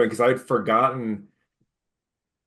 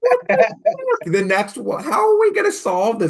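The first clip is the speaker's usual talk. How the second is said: loud, very high-pitched disbelief; hammed-up bewildered outburst; retold exasperated shock; 13 semitones higher, 6 dB louder than usual. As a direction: crack up high in mock panic. Retelling his stunned reaction to episode.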